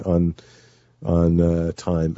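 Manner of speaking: ordinary talk, nothing marked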